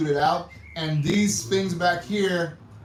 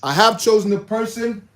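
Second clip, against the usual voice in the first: excited build-up; 4 semitones higher, 7 dB louder than usual. Real, not acted. Dramatic build to naming winner.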